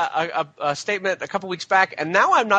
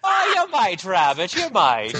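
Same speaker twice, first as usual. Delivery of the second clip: cartoon catchphrase voice; slower than usual. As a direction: echo the cartoon voice with gusto.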